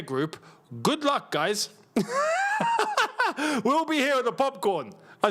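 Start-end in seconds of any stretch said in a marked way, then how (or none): 1.9-3.6 high, mocking cackle at the U S; a high gloating burst of laughter. Real, not acted. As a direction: laugh at their bad luck.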